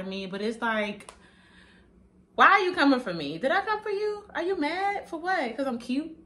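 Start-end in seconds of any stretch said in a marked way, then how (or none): 2.3-6.2 high-pitched, incredulous questioning; loud opening challenge rising into high, sassy questions; mock confrontation. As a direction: call out an imagined hater, voice climbing high. Challenging imagined people who come for her.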